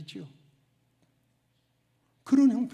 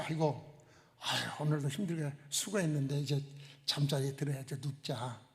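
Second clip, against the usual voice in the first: softer, lower weary mock sigh, fading at the end; drowsy bedtime groan, mildly acted; tired sigh; 5 semitones lower, 6 dB quieter than usual. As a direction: play someone ready for bed after a hard day. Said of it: "그렇게 눕는 걸 말하는 게 아닙니다".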